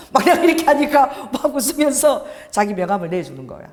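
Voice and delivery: ordinary talk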